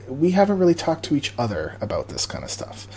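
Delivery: hushed secret whisper. Real, not acted. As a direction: breathe it like a secret. Confiding about a surprise Q and A.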